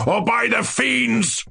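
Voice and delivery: high, drawn-out furious oath; shouted threat. A narrator's reading. King threatening the hesitant dwarf, shouting. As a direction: threaten like a raging tyrant. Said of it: "shouted the monster".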